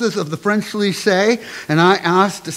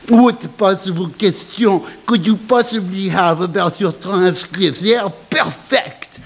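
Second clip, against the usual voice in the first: put-on French accent. Headmistress dismisses student's question, in a French accent. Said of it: "the headmistress chimed in".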